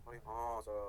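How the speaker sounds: low, soft drawn-out student agreement; mock student reply. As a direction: play a student softly agreeing, pitched well down. Teacher voices the students' reply.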